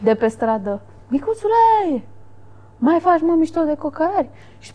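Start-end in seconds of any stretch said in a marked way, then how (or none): 1.1-4.3 retold street shout. Boy heckles the vlogger from the street, mock shouting. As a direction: imitate a lad calling across a street. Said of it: "a țipat un băiat de pe stradă".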